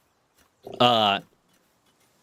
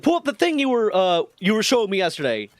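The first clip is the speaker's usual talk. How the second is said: mock Chief voice; 8 semitones higher than usual. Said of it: "It's like".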